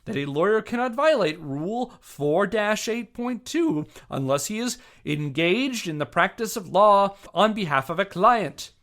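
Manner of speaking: indignant formal statement. Acted. Reading Jack's statement at the referee, indignant and loud. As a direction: read it like a furious legal brief.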